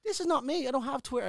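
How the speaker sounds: high, loud insistent denial; loud protest. Retold or imitated. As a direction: protest it loudly, stunned. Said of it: "And I had to go".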